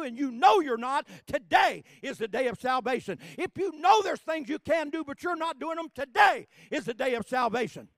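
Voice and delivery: loud, high-pitched preaching; fiery pulpit preaching; preaching at full force. Acted. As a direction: preach it hard to the congregation.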